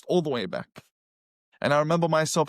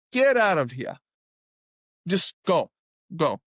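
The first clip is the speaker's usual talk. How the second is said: retold, mildly raised get-out-of-here; shooing off. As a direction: brush him off like shooing a stray. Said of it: "like that".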